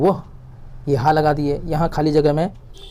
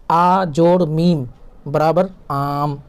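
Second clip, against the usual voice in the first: slow teacher's phonics chant; slow spelling drill; much slower than usual. Shown as a demonstration. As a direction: spell it out slowly, letter by letter. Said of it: "آواز کو لمبا کرنا ہوتا ہے". (استاد کی طرح آہستہ ہجے)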